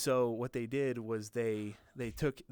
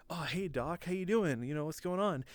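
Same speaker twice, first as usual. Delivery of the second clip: higher-pitched, overly casual greeting; 5 semitones higher than usual. Retold or imitated. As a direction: greet the doctor like nothing is wrong. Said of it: "just gonna do a recreation here".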